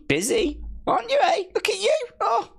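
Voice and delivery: high, mocking sing-song tease; teasing. Streamer teasing Tony about her busy life, high mock coo.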